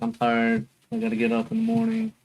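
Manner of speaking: tired whine. Retold or imitated. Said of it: "I was like".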